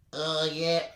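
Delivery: meek cyclist voice; soft, timid soy boy. Acted. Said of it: "differentiate my voice and the cyclist voice".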